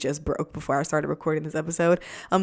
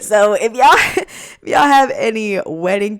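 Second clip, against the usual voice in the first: laughing; 3 semitones higher, 7 dB louder than usual. Real, not acted. Laughing while asking for tips.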